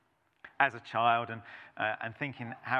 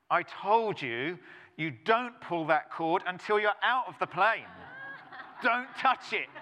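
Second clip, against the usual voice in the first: angry instructor; 5 semitones higher than usual. Retold or imitated. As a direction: play the furious instructor scolding, hammed up. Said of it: "the instructor gets out really angry".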